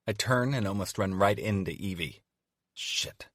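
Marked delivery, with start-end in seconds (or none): 2.7-3.3 breathy whispered shit under the breath; whispered curse. A narrator's reading. Narrator caught off guard, whispering a curse. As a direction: hiss it under your breath.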